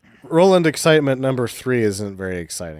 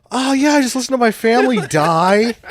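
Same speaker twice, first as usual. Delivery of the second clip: high silly voice; 13 semitones higher, 4 dB louder than usual. Acted. Acted grandfather laughing.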